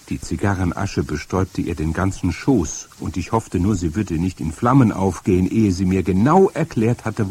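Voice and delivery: ordinary delivery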